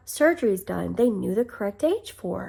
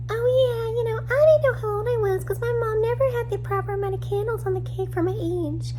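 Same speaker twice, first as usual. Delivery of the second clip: much higher, mocking girlish quote voice; 13 semitones higher than usual. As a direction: parody her innocent excuse up high. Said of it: "her Lifetime interview when she says".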